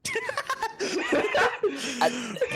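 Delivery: loud squealing laughter; high cackling laugh. Real, not acted. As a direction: burst into high giggles.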